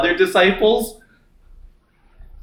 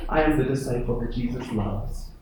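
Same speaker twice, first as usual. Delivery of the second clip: softer, quiet and amazed at being loved; a grateful disciple who can hardly believe it; hushed, humble amazement; humbled wonder; 6 semitones lower, 9 dB quieter than usual. Pretend. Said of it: "He's like".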